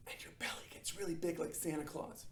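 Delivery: soft breathy whisper over the inhale; hushed demo whisper. Shown as a demonstration. Teacher demonstrating belly breath.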